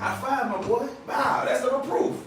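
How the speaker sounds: father praising his son for using the toilet, excited; proud dad praise. Retold or imitated. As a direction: cheer the kid on like he just won.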